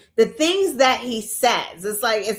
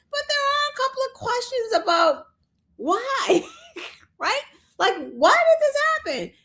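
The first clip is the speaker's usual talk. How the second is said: incredulous; 13 semitones higher than usual. Real, not acted. Incredulous questioning, laughs.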